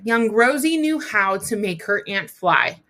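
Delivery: ordinary delivery, nothing marked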